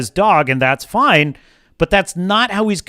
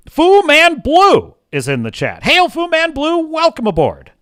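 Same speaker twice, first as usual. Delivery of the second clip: hearty greeting; 10 semitones higher, 4 dB louder than usual. Real, not acted. Greeting a chat viewer.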